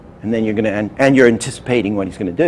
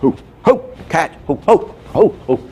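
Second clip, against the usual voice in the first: high, hammed-up "ho, ho" chant; a jolly, much higher mock laugh while catching cards; mock villain laugh; 7 semitones higher than usual. Retelling the hypnotist juggling cards, high mock laughing. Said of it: "he's going".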